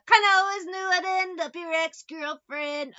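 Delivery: put-on squeaky boyish comedic man; Pee Wee Herman. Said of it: "like Pee Wee Herman".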